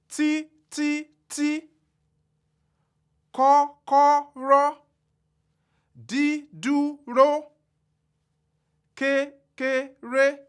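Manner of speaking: kọ́kọ́rọ́, dídúró, kékeré held high and drawn out slowly; every syllable of kọ́kọ́rọ́ level and high, slow; high tone three syllables. Shown as a demonstration. Teacher spelling out trisyllabic high tone, high and slow. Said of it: "high tone occur in trisyllabic words".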